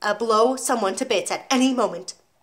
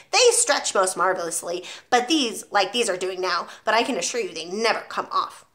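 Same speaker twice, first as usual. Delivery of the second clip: ordinary delivery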